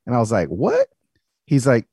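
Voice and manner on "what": high-pitched startled question; surprised. Retold or imitated. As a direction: toss it up high in mild disbelief. Reacts to therapist's blunt verdict.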